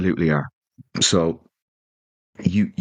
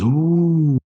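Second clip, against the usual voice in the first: a much higher, drawn-out onlookers' ooh; crowd ooh; 11 semitones higher, 4 dB louder than usual. Retold or imitated. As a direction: voice the whole canteen reacting. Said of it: "Everyone goes".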